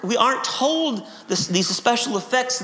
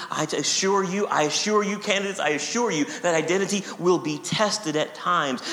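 ordinary talk, nothing marked

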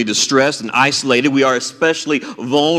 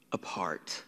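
breathy whispered aside; 9 semitones lower, 18 dB quieter than usual. Real, not acted. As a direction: let the word fall away in a whisper. Pastor whispers painful word.

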